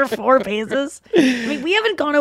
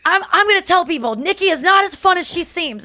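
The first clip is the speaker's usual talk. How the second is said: mock-threaten to tell everyone; imitating Donnell; 8 semitones higher than usual. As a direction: Donnell teasing Nikki about being no fun. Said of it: "He's like".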